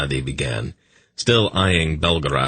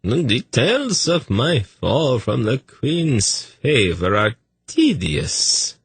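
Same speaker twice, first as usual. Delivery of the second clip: much higher, very slow, fussy eunuch voice; eunuch Tzadi; 8 semitones higher, slower than usual. A narrator's reading. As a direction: lift it up high and drawn out, wary. Tzadi continues.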